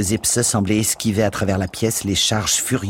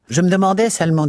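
high, loud disapproving charwoman; Mrs Biggs voice; 11 semitones higher, 6 dB louder, faster than usual. A narrator's reading. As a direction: sniff it out high and prim.

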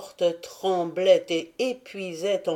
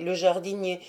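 ordinary delivery, nothing marked

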